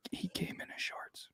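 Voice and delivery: very soft, whispered admission; hushed confiding. Real, not acted. Host confides his friend Zach's embarrassing accident, in a hushed whisper. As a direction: drop to a near-whisper for the embarrassing detail.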